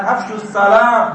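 slow, careful Arabic "Afshus salam"; Arabic recitation. Shown as a demonstration. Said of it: "Makanya Nabi mengatakan".

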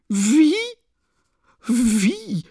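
high, loud cry of disbelief; sudden outcry. A narrator's reading. Katerina coming to herself and rushing at Luschin, outraged cry. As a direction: call out in shrieked disbelief. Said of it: "rief plötzlich Katerina Iwanowna".

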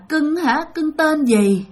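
slow, high, creepy coaxing; eerie sing-song questioning, acted out; leering possessed woman. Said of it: "cái mặt hả là như nham nhở".

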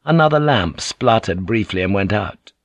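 usual speaking voice

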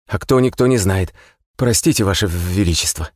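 high, tense messenger report; trembling but firmer report. A narrator's reading. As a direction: push through the fear, firmer. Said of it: "заговорил твёрже".

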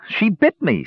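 put-on high-pitched boyish accusation; accusing. A narrator's reading. Boy showing his bitten arm to chief, accusing. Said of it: "said the boy accusingly".

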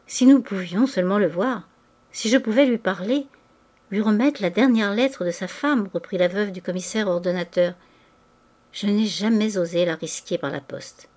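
ordinary delivery

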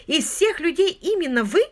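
high, loud disbelief; astonished exclamation. A narrator's reading. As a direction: exclaim it, appalled at who turned up. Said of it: "воскликнула Мэри Уитакер".

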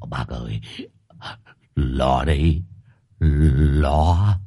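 low, quaking stammer; trembling with fear. A narrator's reading. Frightened husband warns the village head, trembling low stammer. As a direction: get the words out shakily, pitched low. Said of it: "Long gật đầu run run".

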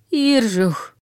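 lightly voiced rasping dead man; a hint of a dead man's wheeze; hoarse corpse croak. A narrator's reading. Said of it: "прохрипело у мертвия".

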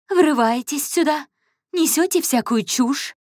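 high, loud indignant cry; indignant outburst. A narrator's reading. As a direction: flare up at the intruder. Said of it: "возмутилась Мария Фергин". (возмущённо, громко и высоко)

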